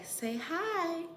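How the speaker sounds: put-on high coaxing baby talk. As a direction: coax the baby to say hi. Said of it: "if you say".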